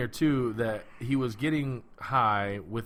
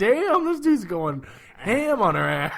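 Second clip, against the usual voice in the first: loud, high, drawn-out disbelief; amazed reaction; 8 semitones higher, 6 dB louder, slower than usual. Retold or imitated. Viewer reacting to the suspect's abuse, hammed-up amazement. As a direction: exclaim it slowly, hugely impressed.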